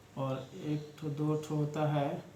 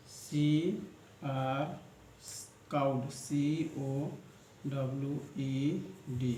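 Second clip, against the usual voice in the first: slow spelling; slower than usual. Shown as a demonstration. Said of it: "teacher spells word slowly".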